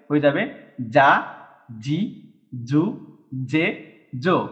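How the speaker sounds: "জা, জি, জু, জে, জো" said clearly with a voiced j; voiced j syllables. Shown as a demonstration. Teacher answers his own question with the new readings, spoken deliberately. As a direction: drill "জা" through "জো" one by one, slowing at the end. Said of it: "তাহলে এটার উচ্চারণ কি হয়ে যাবে?".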